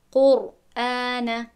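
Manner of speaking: قرآن very slow, ر thick and heavy; heavy Quranic r. Shown as a demonstration. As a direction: draw out قرآن with a full ر. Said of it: "نمیگویم قر".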